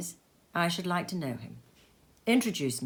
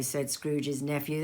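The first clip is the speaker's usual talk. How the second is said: ordinary delivery, nothing marked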